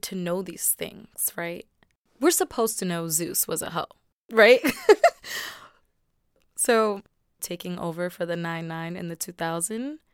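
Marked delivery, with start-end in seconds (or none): 4.7-5.6 high bursting laugh; real laugh; burst of laughter. Real, not acted. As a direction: laugh out loud at the joke. Laughing at own joke.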